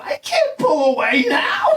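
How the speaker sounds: high, drawn-out whiny cry; acted-out helpless complaint; exasperated protest. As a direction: protest in a stretched, high-pitched wail. Acting out protest to his partner.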